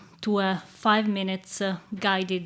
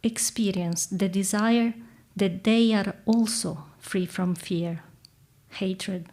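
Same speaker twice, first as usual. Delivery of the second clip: calm meditative tone. Acted. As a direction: guide them gently into stillness. Leads a guided reflection.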